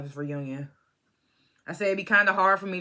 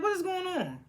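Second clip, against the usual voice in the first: surprised; 12 semitones higher, 4 dB quieter than usual. Retold or imitated. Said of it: "I was like".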